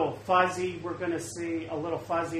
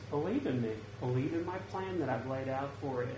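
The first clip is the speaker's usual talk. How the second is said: much softer, gentle inner voice; small quiet voice; 2 semitones lower, 7 dB quieter, faster than usual. Retold or imitated. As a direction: let it sink to a small whisper-like calm. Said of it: "And just in that small voice".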